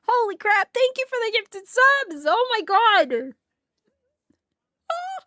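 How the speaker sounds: real gratitude, voice catching; choked up. Real, not acted. Streamer thanking a viewer for gifted subs, near tears. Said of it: "I'm trying not to cry".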